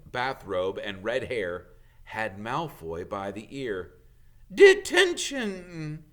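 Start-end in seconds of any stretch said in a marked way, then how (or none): 4.5-6.0 much higher, loud, slow bellow; shout. Acted. McGonagall punishes Malfoy, loud slow shout. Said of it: "she shouted".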